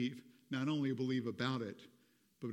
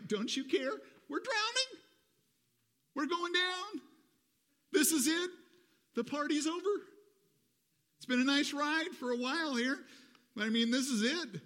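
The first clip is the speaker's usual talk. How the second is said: much higher, desperate disciples crying out; panicked cry; 14 semitones higher, 4 dB louder than usual. Acted. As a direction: wail it like the boat is going down. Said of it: "the disciples cry out to him".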